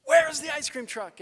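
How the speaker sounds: high, loud holler; hammed-up yelled question; frantic comic yell. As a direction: shout it like an overexcited kid. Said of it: "being like".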